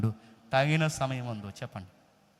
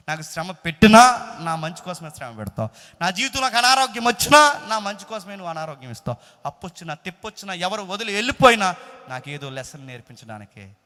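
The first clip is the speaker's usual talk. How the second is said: loud, raised voice speaking as a believer to God; impassioned preaching; 6 semitones higher, 11 dB louder than usual. Acted. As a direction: list each trial with rising conviction. (ఉద్వేగంతో గట్టిగా విశ్వాసపు ప్రకటన)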